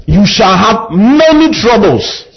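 slow, with many pressed hard; stress on many. Shown as a demonstration. Preacher stressing many troubles to the congregation. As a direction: lean on the word many. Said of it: "sermon climax on many troubles".

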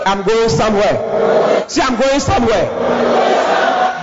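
slow, rising call to the congregation; building church proclamation; preaching declaration. Real, not acted. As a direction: stretch it out high for the crowd to repeat. Preacher leads congregation in chanted declaration.

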